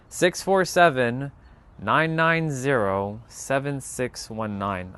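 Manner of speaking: slow, deliberate reading of digits; steady dictation pace; said slowly. Shown as a demonstration. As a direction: read each number clearly so they can write it down. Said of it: "I'll say it slowly".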